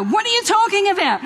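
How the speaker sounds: mock shouted disbelief, high; yelling. Mother shouting at the nurse amid the commotion, high bewildered yell. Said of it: "I'm yelling at her".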